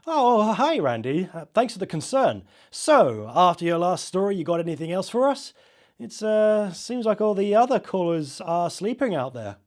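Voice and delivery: loud, high, bright on-air DJ voice; host greeting a caller live on air; upbeat radio host. Acted. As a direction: pump up the on-air host energy. DJ answering on air.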